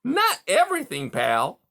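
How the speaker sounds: high, loud chuckling voice; chortling beaver. A narrator's reading. Beaver gloating to caged Rye, high chortling. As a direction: chortle it smugly. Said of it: "Mister Conrad chortled".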